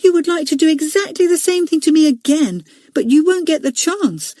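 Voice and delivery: put-on defiant small boy. Leslie to Robert, high defiant boy voice. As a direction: taunt him in a high boyish voice.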